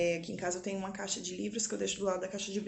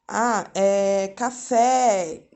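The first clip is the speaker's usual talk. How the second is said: each syllable drawn out, louder; slow dictation; 9 dB louder, much slower than usual. Shown as a demonstration. Mother dictating a word to her son, slow and clear. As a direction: read it out slowly for a child to write.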